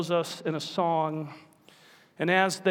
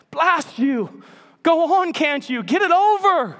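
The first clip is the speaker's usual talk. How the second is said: loud, high, desperate bellow; bellowing ghost; 11 semitones higher, 7 dB louder than usual. Acted. Ghost bellowing at the angel to finish it, loud high bellow. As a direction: roar at the angel to get it over with. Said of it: "bellowed the ghost".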